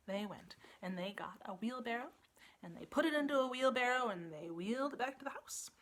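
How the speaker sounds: lively read-aloud, big swings for effect; storybook narration. A narrator's reading. Storyteller reading the turnip tale to children, animated. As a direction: tell it like bedtime story theatre.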